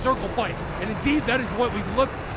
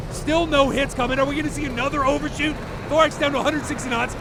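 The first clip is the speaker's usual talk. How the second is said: ordinary talk, nothing marked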